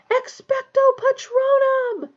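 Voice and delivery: high, loud, drawn-out yell; yelled incantation. A narrator's reading. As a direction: yell the spell slowly with full force. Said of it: "Harry yelled".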